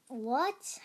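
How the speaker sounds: ordinary talk, nothing marked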